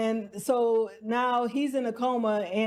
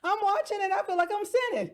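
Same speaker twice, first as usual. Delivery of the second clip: guilty laugh; 7 semitones higher, faster than usual. Real, not acted.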